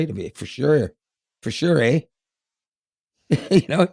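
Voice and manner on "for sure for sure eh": put-on a light Canadian accent on "For sure, eh?", with a rising "eh". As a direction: drop a casual Canadian "For sure, eh?". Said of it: "there was totally a Canadian accent in it".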